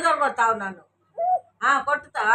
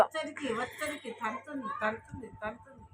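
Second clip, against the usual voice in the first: soft cooing; 3 semitones lower, 9 dB quieter than usual. Real, not acted. Grandma softly cooing to calm the baby.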